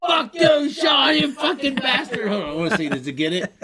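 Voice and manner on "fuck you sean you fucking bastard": high, loud shout full of laughing outrage; real yelling. Real, not acted. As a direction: yell it at your mate across the room. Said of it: "I'm fucking yelling at you".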